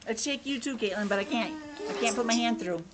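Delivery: usual speaking voice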